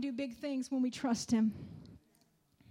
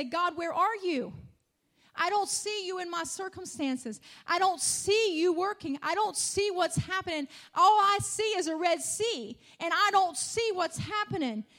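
a doubter crying out to God, higher and louder; pleading complaint; 4 semitones higher, 5 dB louder than usual. Acted. Preacher voicing believers' complaint to God, raised and pleading. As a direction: cry out the lament to God.

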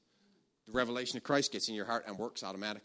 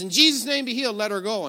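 loud, raised-pitch preacher's command; shouted command; 8 semitones higher, 8 dB louder, faster than usual. Retold or imitated. Healer commanding healing over the lady, shouting. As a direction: call out the healing forcefully, easing slightly.

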